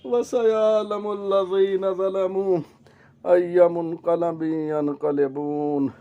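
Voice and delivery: slow, settling Arabic recitation; sinking, slow closing dua; closing prayer recitation. Acted. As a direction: recite the verse and supplication slowly.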